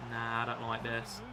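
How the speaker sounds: usual speaking voice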